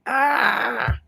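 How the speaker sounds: high, drawn-out cry; acted scream. Foe hit by the X-Men, high mock scream. Villain cries out in fight.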